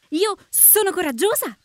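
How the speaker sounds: high, swooping wasp voice; high-pitched proud claim; boastful wasp. Acted. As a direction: pipe up boldly as a little wasp.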